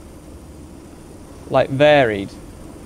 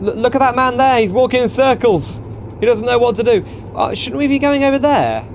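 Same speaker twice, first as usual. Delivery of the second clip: high, amazed and amused; can't believe what he's seeing; amused disbelief; 8 semitones higher than usual. Real, not acted. Amazed at man walking circles.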